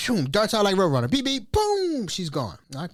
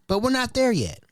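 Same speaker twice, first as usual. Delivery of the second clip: ordinary delivery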